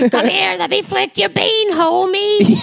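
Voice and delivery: Marge Simpson voice. Retold or imitated. Joking to a friend in a cartoon mom voice, high and raspy. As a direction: croon it like a cartoon housewife. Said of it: "talk like Marge Simpson".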